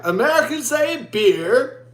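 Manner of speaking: high, slow imitation of an Australian; mock Australian accent. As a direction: put on an Australian drawl, pitched high. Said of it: "he's, he's like".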